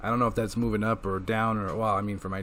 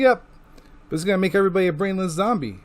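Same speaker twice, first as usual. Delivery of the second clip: higher, fast, hammed-up villain; mock villain; 7 semitones higher, much faster than usual. Serpentor explaining the zombie plan, higher and fast. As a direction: rattle it off gleefully. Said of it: "He's like".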